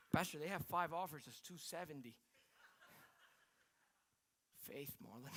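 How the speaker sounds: low, hushed acted exchange; quietly deflated back-and-forth; subdued retelling. Retold house-bidding phone exchange, deflating.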